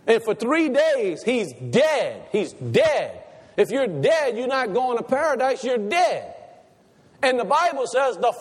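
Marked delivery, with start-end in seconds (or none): none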